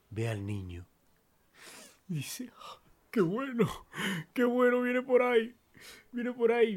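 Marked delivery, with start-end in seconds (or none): 2.6-6.8 high, loud relieved cry; suddenly overjoyed help is here; relieved excitement. Acted. Injured man sees child returning, high relieved cry.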